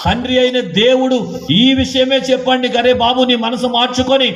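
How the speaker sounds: high, impassioned preacher's appeal; fervent preaching. Acted. Preacher urging the congregation to repent, high and fervent. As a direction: plead with the congregation from the pulpit.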